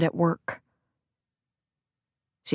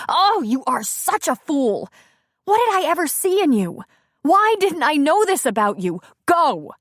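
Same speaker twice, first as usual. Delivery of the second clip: angry scorn; 9 semitones higher, 3 dB louder than usual. Acted. Bree scorning him.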